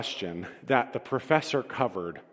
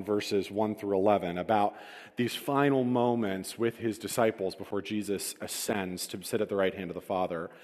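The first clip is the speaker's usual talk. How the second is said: ordinary talk, nothing marked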